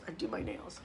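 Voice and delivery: whispered aside. Real, not acted. Repeats it as an aside.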